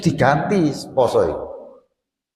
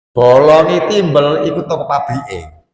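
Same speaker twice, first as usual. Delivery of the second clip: ordinary delivery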